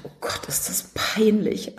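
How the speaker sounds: embarrassed whisper. Retold or imitated. Embarrassed about misspelt name.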